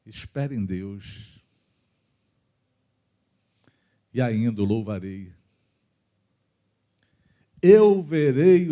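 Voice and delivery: slow, prayerful reading of a psalm; solemn psalm recitation. Retold or imitated. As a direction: savour each line as if praying it, unhurried. Reciting Psalm 42 while praying. (leitura lenta e solene de salmo)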